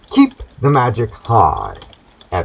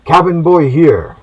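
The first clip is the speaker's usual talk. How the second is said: put-on a slightly higher, slow, keen young sailor; eager cabin boy; 6 semitones higher, 4 dB louder than usual. Cabin boy answering the captain's summons, lightly voiced. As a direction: report for duty like an eager boy. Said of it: "deck and says".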